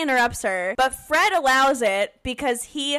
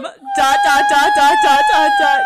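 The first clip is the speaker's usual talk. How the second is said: much higher, louder sing-song dots; squeaky dots; 9 dB louder than usual. Real, not acted. Co-host teasing the implication with high loud dots. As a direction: squeak each dot teasingly.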